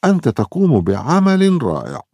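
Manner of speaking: high, loud praise full of zeal; proud dad cheering; enthusiastic. A narrator's reading. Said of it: "يقول والد ليو مفعما بالحماس".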